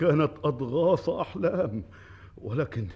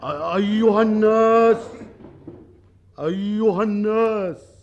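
royal proclamation; 5 semitones higher, 5 dB louder than usual. Acted. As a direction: declaim it to the whole crowd. King proclaims to the people.

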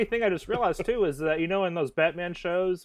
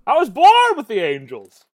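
dramatic declaration; 3 semitones higher, 9 dB louder, slower than usual. Pretend. Mocking dramatic echo.

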